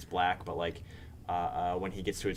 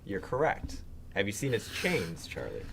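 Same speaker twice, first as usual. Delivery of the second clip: usual speaking voice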